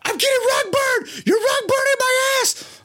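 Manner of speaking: high-pitched, agonized mock scream; screaming bloody murder. Storyteller reenacting his locker room wedgie, screaming bloody murder. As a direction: shriek it like you're being hurt, hammed up. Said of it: "I'm screaming bloody murder".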